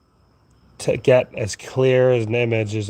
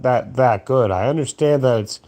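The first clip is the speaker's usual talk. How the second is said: ordinary talk, nothing marked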